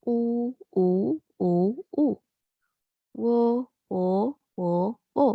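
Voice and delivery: "U, U" with w almost dropped; w nearly silent. Shown as a demonstration. Teacher demos Mandarin w almost silent. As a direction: soften the w in "wo" to near nothing. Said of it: "W-nya dibaca kayak agak hilang gitu".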